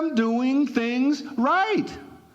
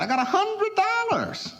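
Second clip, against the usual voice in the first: higher, lightly voiced satisfied man; pleased boast; 10 semitones higher than usual. Prosperity man pleased with his hundred dollars, mock satisfied. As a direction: savour owning the hundred, pitched up.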